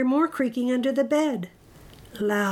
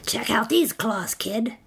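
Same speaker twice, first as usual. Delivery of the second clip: raspy monster voice; raspy monster showing off; rasped. A narrator's reading. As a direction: rasp it out to the kid. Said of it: "a third voice from under the bed rasped".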